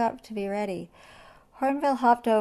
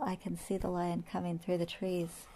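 soft, secretive lookout's report; hushed warning; 8 dB quieter than usual. A narrator's reading. Hornbill warns hidden friends of lion, hushed. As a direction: keep your voice down so he won't hear.

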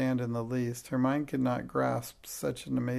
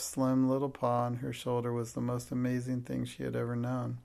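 ordinary delivery

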